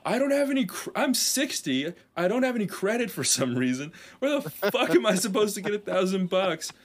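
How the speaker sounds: high-pitched pleading whine of protest; Sean. Acted. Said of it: "He's like".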